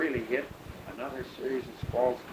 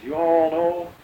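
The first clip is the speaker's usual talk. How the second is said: imitating a North Carolina drawl, louder; retold airman's "You all know" in a North Carolina drawl; 5 semitones higher, 10 dB louder, slower than usual. Pilot quoting a North Carolina airman to the interviewer, in a louder North Carolina drawl. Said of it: "the North Carolina drawl".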